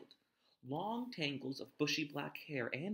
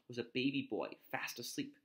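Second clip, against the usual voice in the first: ordinary delivery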